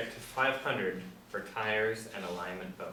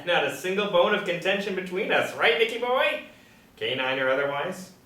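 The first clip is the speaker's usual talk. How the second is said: loud laughing, higher banter; laughing tease; 9 semitones higher, 12 dB louder than usual. Acted. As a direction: tease him through a laugh. Henry laughs, teasing Nick.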